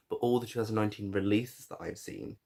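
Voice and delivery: normal talking voice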